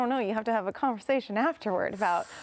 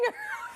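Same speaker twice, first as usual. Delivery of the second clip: high laugh; 7 dB quieter than usual. Real, not acted. Laughing at own idea.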